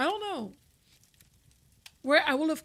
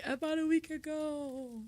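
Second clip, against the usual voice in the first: quiet mock Canadian; 3 semitones higher, 8 dB quieter than usual. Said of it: "I have to say".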